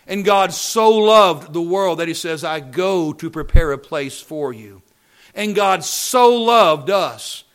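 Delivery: loud refrain with slow, drawn-out key words; full-force preaching. Real, not acted. Preacher proclaiming God's love to congregation, full-force preaching. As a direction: hammer the refrain out to the pews.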